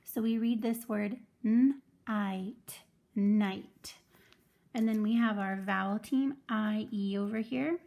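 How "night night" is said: slow, careful decoding of night; sounding out slowly. Shown as a demonstration. Teacher slowly sounds out night for first graders. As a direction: stretch out night so kids hear the long I. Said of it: "he does all the talking for the team".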